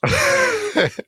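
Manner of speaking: a high, bubbling laugh; real laugh. Real, not acted. Guest laughing at her own story about French.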